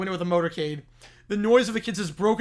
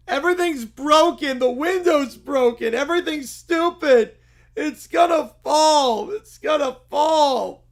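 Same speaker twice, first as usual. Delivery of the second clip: put-on high, loud, slow child wailing in alarm; panicked kid; 7 semitones higher, 6 dB louder, slower than usual. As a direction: wail as if everything is falling apart. Bear cub panics.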